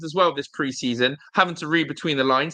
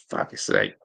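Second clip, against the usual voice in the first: frustrated whisper. Real, not acted. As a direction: whisper "Fuck's sake" under your breath. Frustrated while scrolling for quote.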